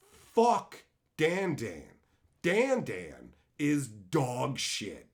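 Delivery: very slow, deliberate delivery; drawn-out, weighty pronouncement; emphatic verdict. Real, not acted. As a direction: lay down each word slowly.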